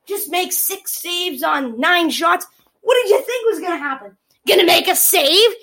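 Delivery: usual speaking voice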